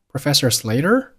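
"Slater" climbing high into a question; rising tune. Shown as a demonstration. Teacher modelling a questioned name for learners, rising intonation. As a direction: lift your pitch steeply on "Slater". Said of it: "Professor Slater语调上扬".

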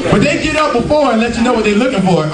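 ordinary talk, nothing marked

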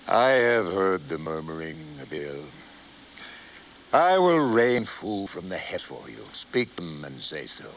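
the Lord speaking to Moses, low and distant. A narrator's reading. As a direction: speak as God from afar.

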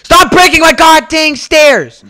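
loud, high, slow furious shout; angry yelling. Real, not acted. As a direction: bellow at him to stop. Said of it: "You sound like a villain".

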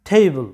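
টেবল as TAY-bul, aspirated t; correct pronunciation. Shown as a demonstration. Teacher introduces word five to students, correct pronunciation. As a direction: model টেবল English-style, falling. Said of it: "এইটার উচ্চারণটা হবে".

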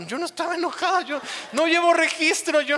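mock offended. Mimicking offended churchgoer.

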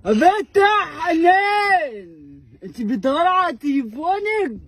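high, slow crying shout; crying call. Acted. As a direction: call her out in mock tears. (نداء بعياط وصوت عالي لحنان)

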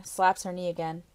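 plain reading voice

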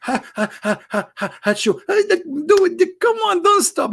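very high, squeaky mocking laugh and cheer; mock Allah laughing. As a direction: cackle high and gleeful, egging them on. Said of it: "Allah will be like in heaven, like".

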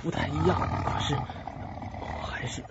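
soft, slow whispered love confession. Acted. Leon confessing love to Lily before death, whispered. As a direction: breathe the words to her tenderly.

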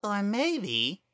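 high mocking voice; taunting flower. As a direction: needle him about the king.

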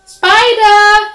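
high, loud call for a friend; loud storybook shout. A narrator's reading. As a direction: holler the name across the yard. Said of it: "he called".

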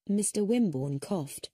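ordinary delivery

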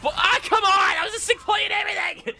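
very high, loud gamer rage; piercing outburst of exasperation; frustrated screaming. Real, not acted. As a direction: scream in protest at the game. Outraged at game going wrong.

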